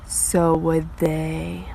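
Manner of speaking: drawn out very slowly and low; slow ominous. Real, not acted. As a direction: let it land like a slow threat. Neighbor copying decorations.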